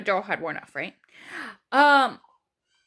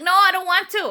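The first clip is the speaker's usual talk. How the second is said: high, louder mock whine; protesting refusal; 7 semitones higher, 6 dB louder than usual. Patient refusing the doctor another push, acted-out protest.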